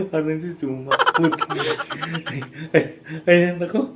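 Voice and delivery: giggling through the words, pitch jumping high; can't stop laughing while explaining. Real, not acted. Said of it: "Sorry, ako natatawa". (natatawa habang nagsasalita)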